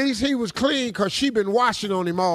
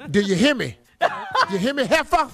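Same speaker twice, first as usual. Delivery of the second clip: menacing warning; 5 semitones higher, faster than usual. Retold or imitated. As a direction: bark the threat with a laugh. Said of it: "How she say it, Steve?".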